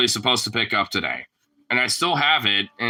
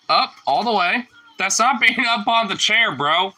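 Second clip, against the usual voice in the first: high-pitched, chiding instruction; insistent pet command; 9 semitones higher than usual. Real, not acted. Owner ordering the dog up on the chair, high and insistent.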